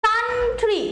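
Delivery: Country with a high, held কান and falling tree; stress on first syllable. Shown as a demonstration. Teacher giving another noun example, country stressed on কান. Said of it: "কান্টে আমার জোরটা বেশি পড়ছে".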